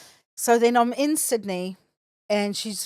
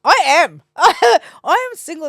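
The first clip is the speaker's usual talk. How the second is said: proud and happy; 7 semitones higher, 9 dB louder, slower than usual. Retold or imitated.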